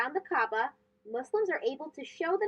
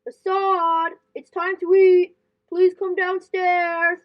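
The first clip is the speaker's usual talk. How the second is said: calling out; 3 semitones higher, 8 dB louder, slower than usual. Acted. Mom calls him to dinner.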